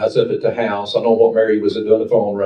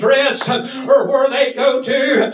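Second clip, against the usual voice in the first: full-force preaching; 12 semitones higher than usual. Real, not acted. Preaching at full force over music.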